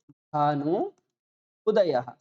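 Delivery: Bhanu and उदयः said slowly as two words, with a gap between; slow, parted Bhanu ... उदयः; slow separate words. Shown as a demonstration. Teacher showing the words apart before joining them. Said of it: "इन दो पदों को जितने साथ बोलने का प्रयत्न करोगे".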